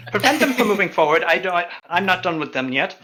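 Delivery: commanding character. Acted. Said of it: "She turns to the knight and goes".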